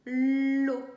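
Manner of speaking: "l'eau" loud, with a long held l; syllable sounded out. Shown as a demonstration. Teacher voicing the syllable as it is written, loud and drawn out. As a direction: hold the l of "l'eau" loudly before the vowel. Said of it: "Et on peut écrire".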